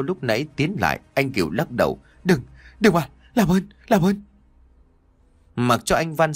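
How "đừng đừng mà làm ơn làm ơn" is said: terrified begging. Acted. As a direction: beg for your life. Victim begs before blade falls.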